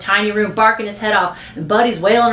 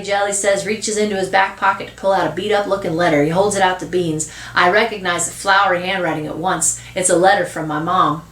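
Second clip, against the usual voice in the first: usual speaking voice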